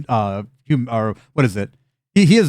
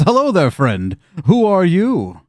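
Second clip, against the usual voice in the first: booming hearty greeting; hearty stranger greeting Shen; friendly stranger; 6 semitones higher, 4 dB louder, slower than usual. Acted. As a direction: greet him big and warm. GM voices tall stranger.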